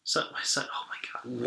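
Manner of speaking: whispered under her breath; embarrassed whisper. Real, not acted. Guest realizes she got the day wrong, whispering. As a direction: caught out, whisper it to yourself.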